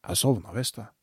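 lightly voiced man half asleep; sleepy mumble. A narrator's reading. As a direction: mumble it heavy with sleep, understated. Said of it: "tung av søvn mumler han".

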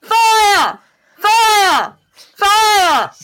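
loud, high alarm shouts; shouting. Acted. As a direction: bellow the warning down the hallway. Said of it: "just shout".

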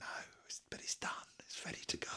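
barely audible, hushed; secretive whisper. Pretend. Host saying the interview is ready, whispered. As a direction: whisper it conspiratorially. Mock secret aside on a podcast.

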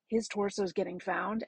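ordinary delivery

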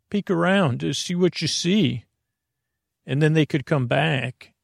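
ordinary talk

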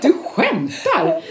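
slow, high, laughing disbelief; amazed disbelief. Retold or imitated. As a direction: drag it out in stunned delight.

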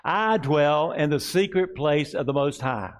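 slow, deliberate congregational recitation; slow call-and-response declaration. Shown as a demonstration. Pastor leading the congregation in a slow declaration. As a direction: lead it slowly for the room to repeat. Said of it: "Let's say it again".

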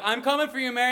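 high-pitched heroic rescue call; hammed-up heroic promise; would-be hero. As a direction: play the hero rushing in. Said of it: "the hero here to the story".

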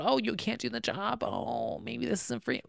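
high, soft mocking put-on voice; mimicking a boss who brushes off a depressed worker, high and soft. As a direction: sneer like a dismissive boss, lightly. Mocking a dismissive employer.

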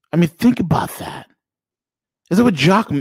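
usual speaking voice

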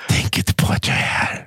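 mock meditation whisper, no voice at all. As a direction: breathe the line without voice.

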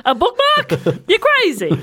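high-pitched, laughing scoff; amused pretend disbelief; mock incredulity. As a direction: scoff high, playing along with the joke. Joining the bit, scoffing.

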